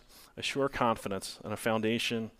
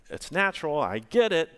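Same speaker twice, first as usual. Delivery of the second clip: ordinary talk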